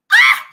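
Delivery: high, loud yelp of exclamation; sharp cry. Real, not acted. Host crying out mid-sermon to listeners, high loud exclaim. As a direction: burst out with a high Ah.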